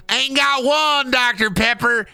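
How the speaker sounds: very high, drawn-out Southern voice; slow Southern put-on, breaking into laughs; Southern character. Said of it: "That's my Southern character".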